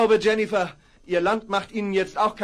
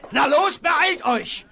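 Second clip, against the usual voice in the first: higher, louder, slow urging call; higher, slow call to hurry; 8 semitones higher, 4 dB louder, much slower than usual. Acted. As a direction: drive them on to hurry.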